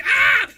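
high, very loud scream; reenacted scream of stage panic; panicked yell. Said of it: "I'm, like, yelling".

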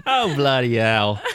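drawn-out joking Cockney; mock Cockney, slow and exaggerated; bad Cockney accent. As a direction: put on a hammed-up Cockney. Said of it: "He actually started with a Cockney accent".

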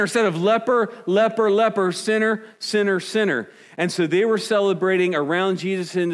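high, loud preaching pitch; full-force preaching. Acted. Pastor firing up the congregation, preaching loud and high. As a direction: preach it hard and high to the room.

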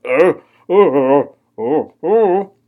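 rising sleep grunts, loud and strained; escalating loud sleep grunts. Retold or imitated. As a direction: grunt harder with each oh, half asleep. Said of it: "I grunted louder and louder".

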